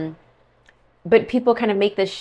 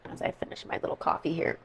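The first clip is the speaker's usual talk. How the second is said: whispered aside; 13 dB quieter than usual. Real, not acted. Whispered aside while sipping coffee.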